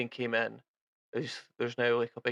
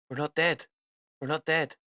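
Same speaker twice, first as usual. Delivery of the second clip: a bit higher, pressing insistence; lightly raised urgent voice; urgent NPC; 3 semitones higher, 3 dB louder than usual. Acted. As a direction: insist they're not dead yet. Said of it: "he's like".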